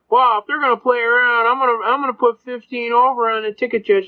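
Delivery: hoog en opgewonden agent; de agent wil de boete verhogen, hoge stem; mock cop voice. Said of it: "The cop goes".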